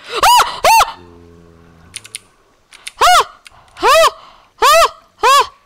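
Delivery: high, loud panicked cries; alarmed yelps. Real, not acted. Gamer yelps at each close call. Danger in game.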